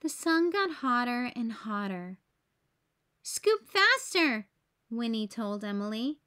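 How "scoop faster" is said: high, drawn-out storybook command; urgent urging. A narrator's reading. Winnie tells Emily the ice cream is melting, urgent urging. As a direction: hurry her along, pitched up and slow.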